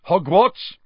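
loud, high, sudden question; abrupt. A narrator's reading. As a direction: fire it out abruptly. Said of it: "he said abruptly".

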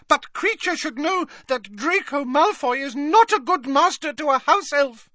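very high, defiant squeak; defiant squeaky elf. A narrator's reading. Elf straightening up to challenge his rival, squeaking defiantly. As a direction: stand up to a rival in a tiny voice. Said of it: "said defiantly to Creature".

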